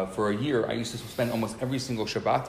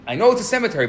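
loud, high imitated retort; exasperated; 11 semitones higher, 11 dB louder, faster than usual. Stranger told the obvious about the cemetery, imitated exasperated retort. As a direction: cut in impatiently, much louder. Retold irritated stranger cuts in.

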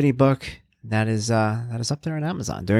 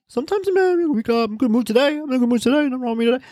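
high, squeaky babble sending up his own waffle; mock rambling answer; 12 semitones higher, faster than usual. Host mimics his own endless answer to "are you happy", high-pitched babble. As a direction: babble it high, words tumbling into each other.

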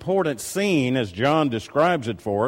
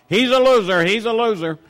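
higher and louder imitated child's shout; mock shout; 9 semitones higher, 5 dB louder than usual. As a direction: belt it out across the room, mimicking her. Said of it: "don't shout out to the whole restaurant".

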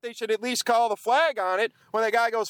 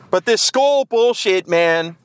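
skull hit loud and slow, much louder; loud indignant repeat; 8 dB louder, slower than usual. Real, not acted. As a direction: come back at it harder and louder.

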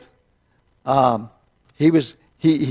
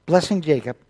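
normal talking voice

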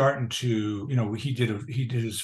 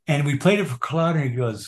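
ordinary talk

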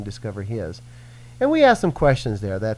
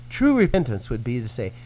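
normal talking voice

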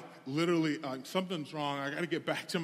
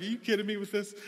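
higher-pitched exasperated question; frustrated disbelief; 5 semitones higher, faster than usual. Retold or imitated. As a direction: retell it in fed-up disbelief. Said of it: "so frustrated".

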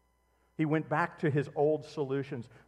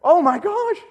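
high, loud mock gasp of alarm; shocked cry; 14 dB louder than usual. Preacher voicing the king's fright, high and loud. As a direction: cry out as if terrified by the writing. Imagined reaction to writing on wall.

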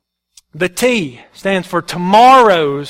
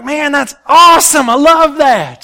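excited; 5 semitones higher, 5 dB louder than usual. Retold or imitated. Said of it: "And I'm like".

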